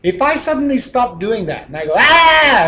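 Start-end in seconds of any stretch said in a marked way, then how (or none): 1.9-2.7 sharp high startling "Ah!", much louder; a jolting loud yelp to break the pattern; sudden yelp. Shown as a demonstration. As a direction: blurt a shock noise mid-talk. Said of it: "I make that noise".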